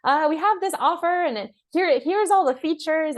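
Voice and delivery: high-pitched, flustered sales pitch; mock flustered. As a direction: act out a seller getting flustered. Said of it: "instead of being all flustered".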